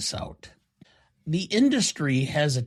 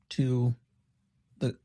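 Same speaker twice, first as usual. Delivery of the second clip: normal talking voice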